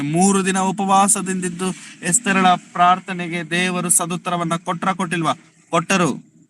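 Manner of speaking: high-pitched, insistent preacher's exhortation; fervent preaching. Acted. Exhorts congregation to pray. (ಎತ್ತರದ ದನಿಯಲ್ಲಿ ಆವೇಶದ ಪ್ರಸಂಗ)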